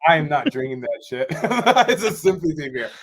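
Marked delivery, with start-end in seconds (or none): 1.3-1.8 burst of laughter. Real, not acted. Laughing at the sympathy beer. 1.9-2.9 laughing. Real, not acted. Laughing at the sympathy beer.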